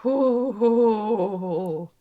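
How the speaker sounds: ordinary talk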